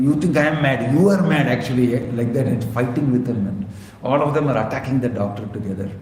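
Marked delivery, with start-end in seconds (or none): 0.0-1.9 high, loud indignant retort; madman retorting. Retold or imitated. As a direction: fling the accusation back at the doctor. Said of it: "the fellow is telling".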